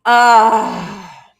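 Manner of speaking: ordinary talk, nothing marked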